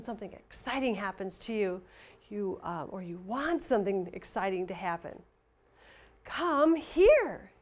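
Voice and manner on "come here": high, loud "Come here." with a falling punch on "here"; excited exclamation. Shown as a demonstration. Teacher showing an exclamation, excited. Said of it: "Hear how that, that excitedness at the end?".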